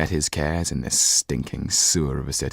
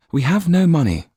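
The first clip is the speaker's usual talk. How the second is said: higher, louder, hurried line; quick, hurried; 13 semitones higher, 7 dB louder than usual. A narrator's reading. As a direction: blurt it out fast. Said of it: "said Sido quickly".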